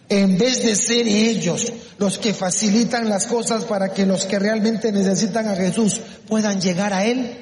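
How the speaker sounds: ordinary talk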